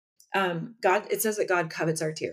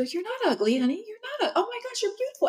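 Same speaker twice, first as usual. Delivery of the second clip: high, gushing mom voice, much higher; mom reassuring daughter. Retold or imitated. Mother reassures her crying little girl, high and gushing. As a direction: play the doting mom insisting she's beautiful.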